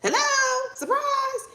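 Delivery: high, loud repeated calls; screaming angels. Acted. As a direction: shriek to get their attention. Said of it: "They got multiple angels screaming at them".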